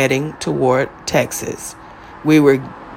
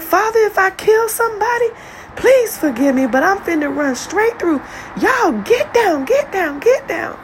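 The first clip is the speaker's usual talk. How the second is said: dad's frantic cry. Retold or imitated. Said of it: "my dad scream out loud".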